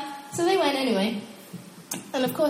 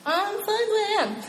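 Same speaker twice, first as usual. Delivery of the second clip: higher, childish mock pout; sulky refusal; 10 semitones higher than usual. Believer sulking at God, higher childish pout. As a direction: sulk like a stubborn teen. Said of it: "And then you're like".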